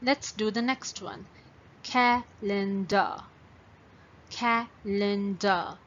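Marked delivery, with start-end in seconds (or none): 1.8-3.2 "Calendar" slowly, in three separate beats; broken into syllables. Shown as a demonstration. Teacher introduces the next word, "Calendar" split into syllables. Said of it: "So how many syllables it has?". 4.3-5.8 "Calendar" slowly, three well-spaced beats; broken into syllables. Shown as a demonstration. Teacher repeats for counting, "Calendar" split into syllables. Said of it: "So how many syllables it has?".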